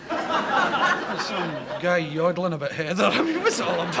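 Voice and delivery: laughing through the words, slowed right down; chuckling at the silly picture he paints. Real, not acted. To the interviewer, mocking tartan kitsch, laughing.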